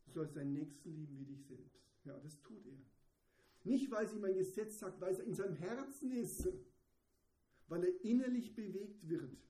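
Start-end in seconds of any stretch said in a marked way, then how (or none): 3.6-9.4 loud, high, urgent preaching; fervent sermon climax; impassioned preaching. Real, not acted. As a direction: drive the point home with fervor. Preaching at full force.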